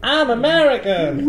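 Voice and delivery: bad American accent, shouted for a laugh; mock American accent. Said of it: "shall we try, like, an American accent?".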